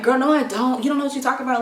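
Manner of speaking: high-pitched mock comeback; defensive attitude. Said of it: "you always get an attitude".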